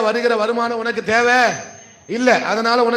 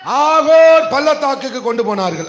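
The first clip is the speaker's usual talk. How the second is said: raised voice, stretched opening word; loud read-out; 4 semitones higher, 5 dB louder than usual. Shown as a demonstration. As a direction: call the verse out for all to hear. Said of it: "இன்னொரு முறை சத்தமா சொல்லு".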